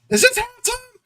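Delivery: squeaky high disbelieving question; high incredulous ask. Retold or imitated. Dad asking the ref if it's halftime, very high disbelief. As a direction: pitch it way up in disbelief.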